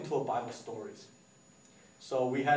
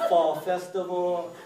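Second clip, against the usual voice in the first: slow, drawn-out mock announcement; laughing, dragged-out parody; mocking name; 2 semitones higher, 4 dB louder, much slower than usual. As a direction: stretch it out to make fun of it. Mocking a dull event name.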